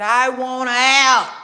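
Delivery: imitating Wendy's loud cry; Wendy crying out to the reporter; loud, slow plea. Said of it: "Wendy said".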